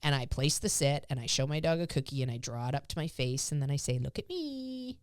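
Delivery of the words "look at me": cue to dog. Shown as a demonstration.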